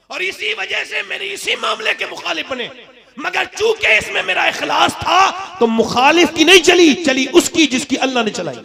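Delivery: high voice swelling to a shout; loud, fervent pulpit oratory; impassioned preaching. Real, not acted. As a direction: build to a ringing declaration.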